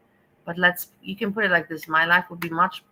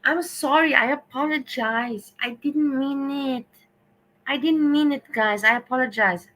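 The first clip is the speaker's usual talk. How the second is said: higher, hammed-up fake remorse; pitched-up sorry that nobody should believe; mock apology; 7 semitones higher than usual. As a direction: whine it out like an insincere sorry. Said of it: "tomorrow I will come on YouTube and I will say".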